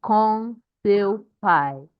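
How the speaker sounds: slow, word-by-word Portuguese with stretched stressed syllables; model reading. Shown as a demonstration. Teacher showing class how to read correctly, slow model reading. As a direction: read it out deliberately, lifting phrase ends. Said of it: "That's how to read correctly in Portuguese".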